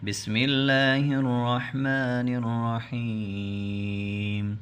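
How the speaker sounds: slow, chanted tilawat with long held vowels; Quranic recitation. Acted. Recitation before the Quran lesson. (قرآنی تلاوت کا انداز)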